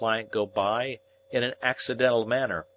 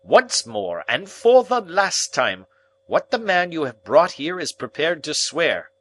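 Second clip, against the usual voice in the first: louder, clipped stern rebuke; 9 semitones higher, 7 dB louder than usual. Acted. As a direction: warn him sternly. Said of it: "said my guardian very sternly".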